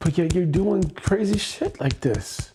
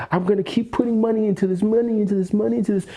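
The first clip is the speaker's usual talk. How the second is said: fast, higher mimicking of a compulsive spender; mocking compulsion; 6 semitones higher, much faster than usual. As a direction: rattle it off in mockery, pitched up. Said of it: "inside of you that is like".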